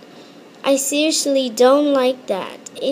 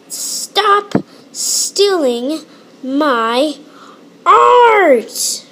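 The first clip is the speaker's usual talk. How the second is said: drawn out words, art yelled much higher and louder; slow build to a shout; 3 semitones higher, 3 dB louder, much slower than usual. Acted. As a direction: stretch it, then explode on art.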